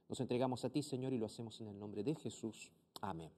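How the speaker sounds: soft, hushed prayer voice. Acted. Pastor leads viewers in prayer, hushed and reverent. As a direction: pray gently, almost under the breath.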